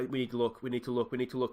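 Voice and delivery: ordinary talk, nothing marked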